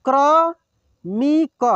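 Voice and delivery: क्रमिक drawn out slowly, syllable by syllable; slow sounding out. Shown as a demonstration. Teacher slowly sounds out क्रमिक for students. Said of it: "एक एक मात्रा को अलग अलग करके".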